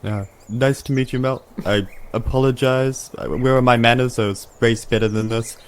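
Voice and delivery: ordinary delivery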